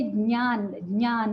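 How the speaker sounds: ज्ञान as "nyaan", first sound nearly silent; correct nasal form. Shown as a demonstration. Teacher gives the answer, ज्ञान as "nyaan". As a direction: begin ज्ञान in the nose with "ny". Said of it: "तो ये क्या है?".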